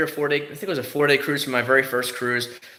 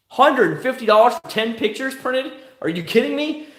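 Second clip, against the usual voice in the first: high, loud disbelief; outraged at the price; incredulous; 12 semitones higher, 4 dB louder than usual. Real, not acted.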